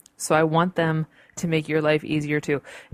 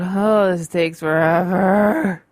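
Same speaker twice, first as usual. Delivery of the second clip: loud, drawn-out mock moan; exasperated whine; 7 dB louder, slower than usual. Podcaster waiting on her slow computer, exasperated whine. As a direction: drag it out in exaggerated impatience.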